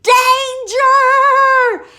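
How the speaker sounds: high, loud sing-song cry of a frantic mother hen; high alarm cry. Acted. Narrator voicing the hen warning her babies with a high, loud cry. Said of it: "The little hen cried".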